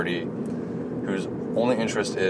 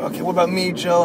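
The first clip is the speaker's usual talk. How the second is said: imitated next challenger, pitched up high; eager player; 11 semitones higher than usual. Next player at the table asks Joe, eager higher voice. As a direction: jump in as another keen player, higher voice.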